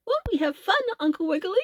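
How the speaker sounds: high, bouncy elephant voice; jolly elephant. A narrator's reading. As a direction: play the happy elephant with big glee. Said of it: "because he felt so jolly".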